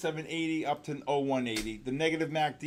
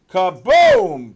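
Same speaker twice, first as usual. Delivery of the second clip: high, booming yell; loud, drawn-out blast of excitement; exultant shout; 10 semitones higher, 16 dB louder than usual. Real, not acted. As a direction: burst out on the second syllable. Celebrating another trade.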